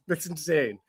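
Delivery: ordinary talk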